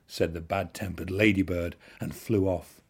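usual speaking voice

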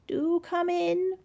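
high, slow invitation; drawn-out, high fake-granny voice; croaky faint voice. A narrator's reading. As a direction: invite her in as a poorly granny. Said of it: "croaked a faint voice".

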